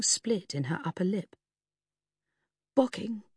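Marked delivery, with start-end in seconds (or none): 2.8-3.2 croaky voice. A narrator's reading. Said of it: "she said in her croaky voice".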